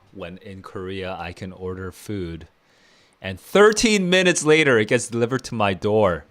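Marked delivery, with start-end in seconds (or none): 3.2-6.3 excited. Real, not acted. Delighted about fast food delivery.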